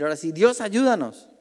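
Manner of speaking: high, loud begging cry to God; desperate plea. Retold or imitated. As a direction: beg God for help in a panic. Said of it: "van a decir".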